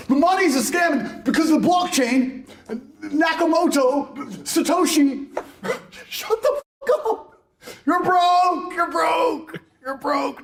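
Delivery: high, laughing mock nerd then shouting back; mocking impression turned into a shouted putdown; mock crypto nerd. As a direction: mimic a squeaky crypto guy, then yell him down. Mocking a small crypto holder.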